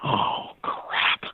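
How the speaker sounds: voiceless, drawn-out dismay; stunned dismay. Retold or imitated. Cyclist reacting to a boy claiming deity, hushed dismay. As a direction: let it out on the breath, hushed.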